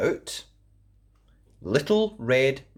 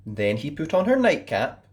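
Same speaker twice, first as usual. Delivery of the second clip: ordinary delivery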